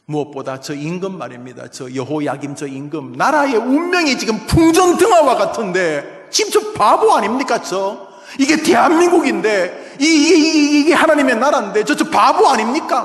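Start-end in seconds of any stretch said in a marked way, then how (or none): 3.1-13.0 high, loud cry of exasperation; anguished outcry. Acted. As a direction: rail against the foolish king. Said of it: "막 하면서 지금 하박국이 절규하고 있는 것이죠".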